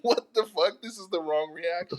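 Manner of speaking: high-pitched baffled outburst; incredulous squeal at the wrong reaction; mock disbelief. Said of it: "and I'm like".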